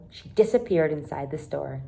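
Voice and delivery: usual speaking voice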